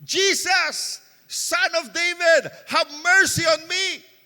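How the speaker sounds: slow, pleading call, voice lifted; crying out. Retold or imitated. Blind Bartimaeus begging Jesus over the crowd, drawn-out cry. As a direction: plead aloud to a passing Jesus. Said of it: "hindi siya bumubulong".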